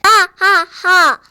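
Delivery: slow, booming evil laugh; booming giant laugh. Acted. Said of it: "골리앗의 울렁창 목소리가 또 들려왔어요".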